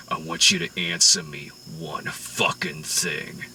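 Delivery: mock-threatening mob boss asking about steak; menacing Whitey. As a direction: lean in low and deadly over dinner. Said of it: "Whitey's like".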